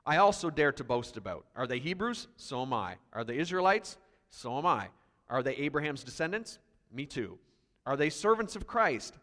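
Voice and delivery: reads the boasts out strongly, each question rising; forceful scripture reading. Acted. A preacher reading Paul's boasts to the church, forceful. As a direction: proclaim the apostle's words to the congregation.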